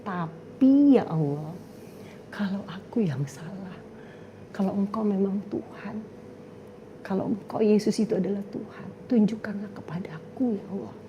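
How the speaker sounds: whispered prayer. Retold or imitated. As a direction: pray in a hush, almost afraid. Retold secret prayer, whispered end.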